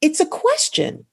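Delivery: "it's a question" swept far up at "question"; rising upspeak. Shown as a demonstration. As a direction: make "question" swing up high like a query. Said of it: "if your thought goes up".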